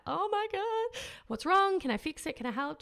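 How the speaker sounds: imitating mum rushing to fix things; worried mum. Mum to her crying daughter, high alarmed fussing. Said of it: "my mum was like".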